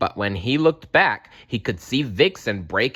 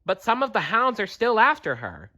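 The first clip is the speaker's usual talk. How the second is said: high bird voice; 13 semitones higher than usual. A narrator's reading. Kestrel voice.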